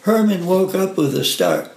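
ordinary delivery

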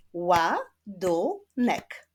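"Ładunek" in three slow, separate beats; split into syllables. Shown as a demonstration. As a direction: clap out "Ładunek" slowly, syllable by syllable. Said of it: "Wyklaszczmy sylaby w wyrazie".

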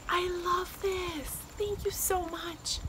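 high, soft and slow; touched gratitude. Real, not acted. Thanking a friend for the planner gift, touched. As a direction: gush softly, drawing out each word.

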